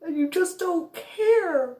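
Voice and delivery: furious through tears, holding nothing back; crying rant. Retold or imitated. Tearful rant at mother.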